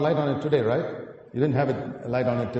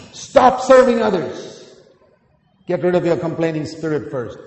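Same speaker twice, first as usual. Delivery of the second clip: forceful command; 4 semitones higher, 9 dB louder than usual. Real, not acted. Preacher exhorting congregation.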